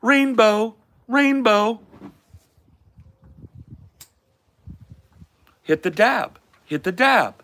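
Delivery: high, looping canned reactions; NPC bot voice. Acted. Streamer acting as an NPC bot reacting to viewer gifts. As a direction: repeat each word like a streaming NPC. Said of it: "You guys wanna try a bot stream".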